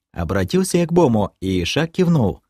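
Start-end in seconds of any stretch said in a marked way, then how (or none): none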